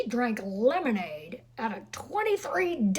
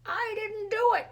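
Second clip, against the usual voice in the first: put-on high, shrill-free kid yell, pitched well up; yelling boy; 12 semitones higher than usual. A narrator's reading. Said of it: "yelled Fritz".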